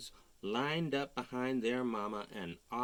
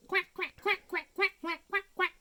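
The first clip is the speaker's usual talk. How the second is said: much higher, louder tiny quacks; ducklings agreeing; 7 dB louder than usual. Acted. Ducklings agreeing with Mama Duck, much higher quacks. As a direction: chirp the quacks as little ducklings. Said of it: "agreed all the ducklings".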